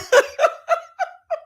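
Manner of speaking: high giggling laugh; real laughter. Real, not acted. Host laughing at their own theme song, high laughter. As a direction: crack up in a high cackle.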